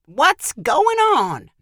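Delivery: high, drawn-out exasperated question; irritated demand. A narrator's reading. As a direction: demand an explanation, put out. Busy wife called outside, annoyed.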